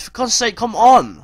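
high, loud burst of frustration; real frustrated outcry; exasperated shout. Real, not acted. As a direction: yell at the team, fed up. Player shoots wide, gamer fed up.

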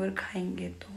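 soft unvoiced whisper; hushed late-night aside. Real, not acted. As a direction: whisper so the sick husband isn't woken. Night, patient sleeping nearby.

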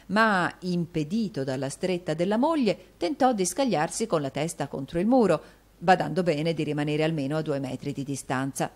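usual speaking voice

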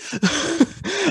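a squeal of high laughter. Real, not acted. Referee laughing at forgetting to ref, high squealing laugh.

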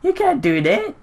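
high squeaky mock protest; mock outrage. Gamer imitating the baffled seeker, high mock outrage. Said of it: "He's just like".

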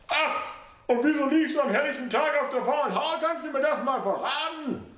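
high mother's voice, sarcastic; mocking old mother. A narrator's reading. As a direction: needle him about lying around.

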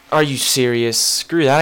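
ordinary talk, nothing marked

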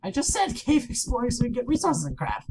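high-pitched, indignant insistence; exasperated protest. Real, not acted. Player repeating the cave plan to a partner, exasperated.

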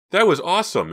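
high, loud exclamation; thrilled. A narrator's reading. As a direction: declare it in pure excitement. Boy thrilled after the chase.

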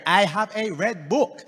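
usual speaking voice

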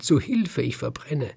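high rooster plea, falling away; urgent cry for help. A narrator's reading. Burning rooster begging the river for rescue, urgent cry. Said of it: "rief der Hahn ganz schnell".

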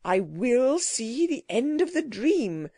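slow, stubborn insistence; obstinate. A narrator's reading. Gentleman refuses the creature's warning, obstinately. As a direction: dig in, dragging out each word. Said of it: "learned gentleman obstinately".

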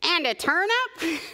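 high, eager interruption; eager Duck. Acted. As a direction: butt in brightly with a big idea. Duck butts into the recipe.

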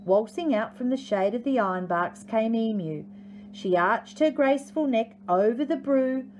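ordinary delivery, nothing marked

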